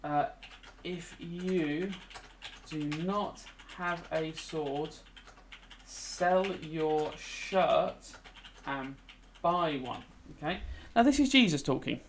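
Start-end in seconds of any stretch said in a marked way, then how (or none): 0.8-10.0 very slow, dragged-out dictation. Shown as a demonstration. Teacher dictating a favourite quote to students, very slowly. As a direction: stretch each word out as you write it.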